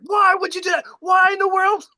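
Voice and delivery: high, loud mock-furious shout; mad as a hornet. Dunked woman confronting the man who threw her, mock fury. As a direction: yell it in imitated outrage. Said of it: "she is mad as a hornet".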